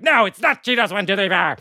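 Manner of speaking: high-pitched mock scolding; exasperated computer. Computer refusing the second password guess, exasperated. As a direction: snap back with hammed-up impatience.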